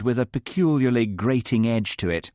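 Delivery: ordinary delivery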